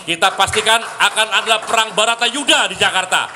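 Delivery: loud, high rally cry; rousing shout. Acted. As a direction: fire up the crowd. Rally climax, repeated line much louder.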